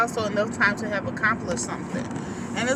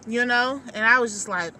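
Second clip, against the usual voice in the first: ordinary delivery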